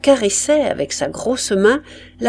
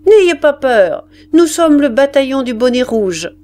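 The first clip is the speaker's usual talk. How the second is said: high, loud proclamation; sergeant's shout; 5 semitones higher, 5 dB louder than usual. A narrator's reading. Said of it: "Le sergent lui cria".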